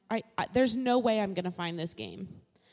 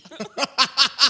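squealing laugh; 9 dB louder than usual. Real, not acted. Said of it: "my squeal of a laugh".

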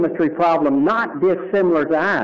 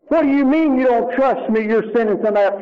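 indignant; 6 semitones higher than usual. Retold or imitated. Angry at psychiatrist.

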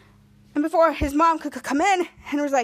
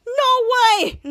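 high, loud acted disbelief; amazed exclamation; 8 semitones higher, 6 dB louder than usual. Henry hearing it's called limp, loud amazed cry. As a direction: blurt it out, stretching both words.

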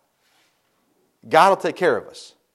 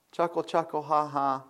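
mock chuckle; 11 dB quieter than usual. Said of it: "he finds this rather comical".

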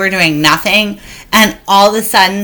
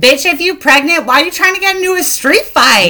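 much higher mock disbelief; incredulous retort; 10 semitones higher than usual. Speaker mocking the pregnant woman for fighting, incredulous. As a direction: throw it back at her in disbelief.

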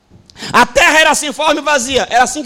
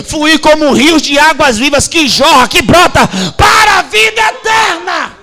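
loud, rising preacher cadence; preaching at full force; 6 dB louder than usual. Real, not acted. Pastor preaching to the congregation, loud and driving. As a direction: hammer each line like a revival preacher.

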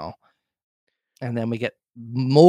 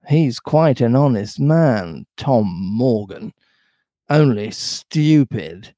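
plain reading voice

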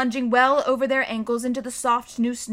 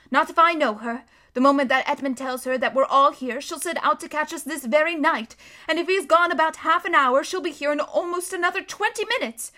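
lightly voiced motherly beaver, higher and earnest; Missus Beaver, urgent; 5 semitones higher, 5 dB louder than usual. A narrator's reading. Missus Beaver warning the children about the witch, earnest. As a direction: warn them kindly but firmly.

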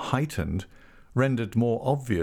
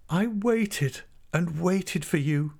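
slow, high, plaintive voice; pathetic, flutelike lament; fluty pathos; 9 semitones higher, slower than usual. A narrator's reading. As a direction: draw it out with high, fluty sorrow. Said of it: "resuming their old fluty pathos".

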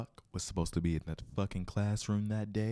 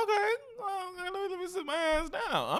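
high mock-nervous voice; 4 dB louder than usual. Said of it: "I'm like".

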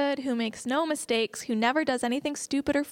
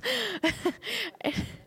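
delighted laugh; 10 semitones higher than usual. Real, not acted. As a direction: laugh with pure joy. Laughing at the verse.

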